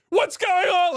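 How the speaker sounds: high, loud mock-panicked yell; freaked out. Whole carriage reacting to news of a knife, acted panic. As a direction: shriek it like an alarmed crowd, acted. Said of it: "freaks the fuck out of everybody".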